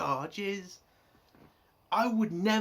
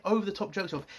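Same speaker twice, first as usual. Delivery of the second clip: ordinary talk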